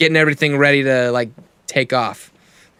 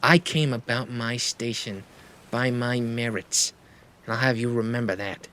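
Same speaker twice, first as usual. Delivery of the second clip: cold rebuke; 7 dB quieter than usual. Acted. Captain offended by accusation.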